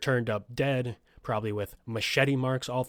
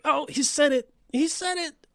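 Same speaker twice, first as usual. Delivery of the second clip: high, louder excited cartoon exclamation; Family Guy; 5 dB louder than usual. Host quoting a cartoon gag about a movie title, high excited voice. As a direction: squeal it high like a cartoon viewer. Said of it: "reminds me of that Family Guy".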